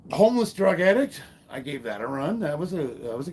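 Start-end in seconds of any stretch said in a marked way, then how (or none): 0.1-1.2 louder, higher comic reveal; telling the audience his real career, ironic punchline. Real, not acted. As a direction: land the joke with a punch. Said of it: "deadpan punchline".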